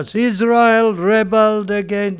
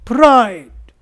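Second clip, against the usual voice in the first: ordinary delivery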